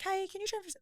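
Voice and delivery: high bright sing-song ask. Retold or imitated. Daughter casually asking mum for money, high and cheery. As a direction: sound breezy and carefree. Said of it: "I'm always so happy and cheerful".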